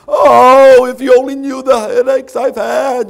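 very loud, high-pitched mock kvetching; hammed-up wail of self-pity; poor moaning. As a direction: complain like a put-upon sufferer. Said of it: "[name] does this poor moaning".

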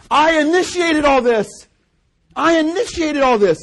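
high, loud pulpit proclamation; full-force shouted preaching; preacher's shout. Acted. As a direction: thunder it as God's own claim. Preacher proclaims in God's words.

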